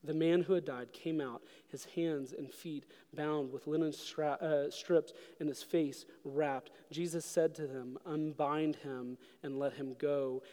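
soft, low, slow reading; hushed scripture reading. Real, not acted. Preacher reading the Lazarus story to mourners, hushed. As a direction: read it gently and slowly to the mourners. Reads scripture and refrain softly.

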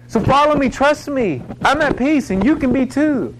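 high, loud, pleading rescuer voice; urgent rescuer plea. Pretend. A rescue swimmer pleading with a drowning person, urgent and high. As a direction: call them out of the water with hope.

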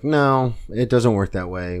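loud, sharp refusal as the landlord; firm landlord no. Retold or imitated. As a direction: shut the offer down hard. Landlord refuses his offer.

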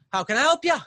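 high, chirpy gnome cook. Acted. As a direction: greet the customer like a perky gnome.

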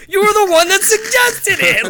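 high, incredulous protest; laughing mock outrage; retold exasperation. Retelling his exasperated text argument.

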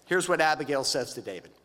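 ordinary talk, nothing marked

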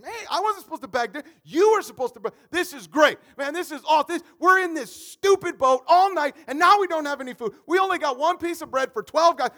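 loud, high, indignant grumbling; defensive complaint. Acted. Disciple arguing back in the boat, loudly indignant. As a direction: push the blame right back.

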